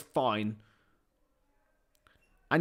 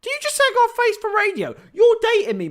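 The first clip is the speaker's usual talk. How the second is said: high, loud, incredulous squeal; mock outrage; 8 dB louder, faster than usual. Real, not acted. Streamer answers friend's face-for-radio jibe, mock outrage. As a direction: fire it back fast and high, affronted.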